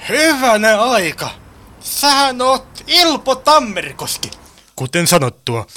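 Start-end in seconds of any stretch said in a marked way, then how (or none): 0.1-4.3 slow, amazed exclamation; astonished recognition. Acted. Driver recognises the masked robber, astonished. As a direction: draw it out in amazed discovery.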